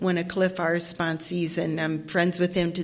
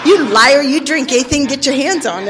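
a higher, louder mocking inner voice calling her out; inner accusing voice; 6 semitones higher, 8 dB louder than usual. Her own inner voice calling her a liar, sharp and loud. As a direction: let the inner critic jab at her. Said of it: "And then that voice, you know, that one over here says".

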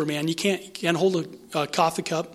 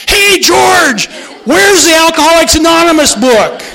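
high loud shout across the store; 11 semitones higher, 12 dB louder than usual. Retold or imitated. Bookstore clerk calls to George across the store, shouting loud. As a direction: bellow it out for the whole shop to hear. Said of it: "Just shouting it out".

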